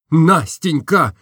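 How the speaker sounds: man crying out her name; cried out. Acted. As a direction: call out, unable to hold back. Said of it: "закричал я".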